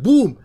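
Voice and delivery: explosive spoken boom; sudden loud "boom". Shown as a demonstration. Teacher likens short prayer to a burst, booming. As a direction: fire it out in one loud burst.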